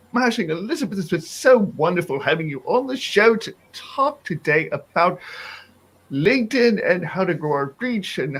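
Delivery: high, gushing mock British accent; put-on British accent. Co-host thanking Elizabeth for the show, hammed-up British accent. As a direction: ham up a British accent, starting very high and easing down. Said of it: "I'm just ruining the accent now".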